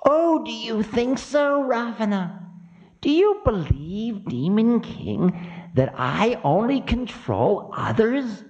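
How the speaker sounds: high-pitched imperious Time figure; high voice of offended Time; Kala, scornful. Acted. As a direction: challenge him in a high, piercing pitch. Voices Kala, Time, against Ravana.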